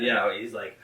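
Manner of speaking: ordinary delivery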